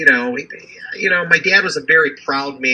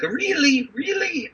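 surprised; 14 semitones higher, 3 dB quieter, slower than usual. Retold or imitated. Dad surprised by son's idea.

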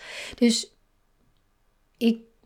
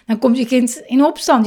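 ordinary delivery

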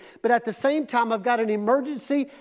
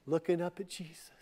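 tender storytelling; 6 semitones lower, 6 dB quieter than usual. A narrator's reading. Preacher softly describes Mary resting at Jesus' feet, hushed and tender. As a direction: paint the quiet scene gently. Soft storytelling of Mary's peace.

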